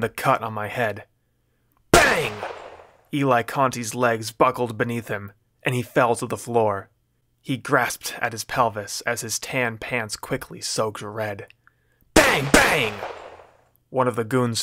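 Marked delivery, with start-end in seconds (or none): 1.9-2.3 loud voiced gunshot bang. A narrator's reading. Narrator voicing a gunshot in the laundromat, loud bang. 12.1-12.9 two loud, high voiced bangs; gunshots. A narrator's reading. Narrator voicing gunfire in the fight, loud bangs.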